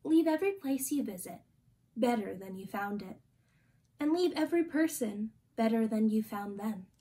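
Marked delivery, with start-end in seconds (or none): none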